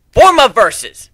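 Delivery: loud, high incantation; spell shout. A narrator's reading. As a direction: cry out the spell. Casting a spell.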